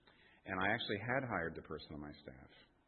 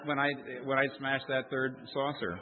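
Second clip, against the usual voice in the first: louder, higher, with a laugh; laughing retold; 7 semitones higher, 8 dB louder than usual. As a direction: laugh while quoting the wife. Wife retold, laughing, loud.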